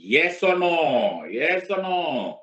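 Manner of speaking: loud, stretched question to class; demanding yes or no. Shown as a demonstration. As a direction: press them for an answer, slowly. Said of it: "teacher demands answer". (ज़ोर से खींचकर पूछना)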